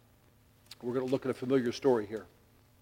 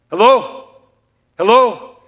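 loud, high, drawn-out hello; calling out loudly; 7 semitones higher, 13 dB louder, much slower than usual. Retold or imitated. Father shouts at distracted teenage son, loud and high. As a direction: call him like he's miles away.

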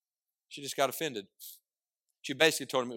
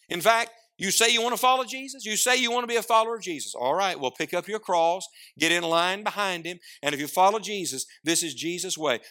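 preaching; 6 semitones higher, 4 dB louder than usual. Acted. Preaching a challenge.

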